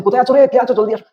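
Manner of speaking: acted loud, high-pitched call to a class; too-loud teacher. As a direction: call out too loudly, demonstrating excessive classroom volume. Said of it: "volume যেন এমন হয়".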